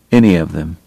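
ordinary delivery

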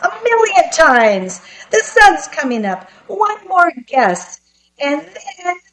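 high-pitched cocky little man; haughty relief. A narrator's reading. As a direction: crow it in a high, triumphant imp voice. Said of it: "man relaxed and let out a haughty laugh".

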